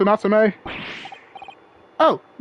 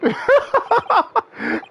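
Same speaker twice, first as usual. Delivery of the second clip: high burst of laughter; amused laugh; 11 semitones higher than usual. Real, not acted. Onlooker laughing at the defendant's defeat. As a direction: laugh out at the joke.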